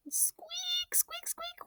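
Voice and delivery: tiny high squeaks, whispered; squeaky pulley sound. A narrator's reading. Boy imitates the clothesline pulley, high squeaky sound. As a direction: squeak it like a rusty pulley wheel.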